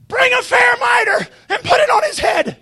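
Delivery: sudden high, excited cry; excited outburst. Acted. Prophet suddenly calling for the mitre, overexcited. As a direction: blurt the command out high in excitement. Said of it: "the prophet gets so excited".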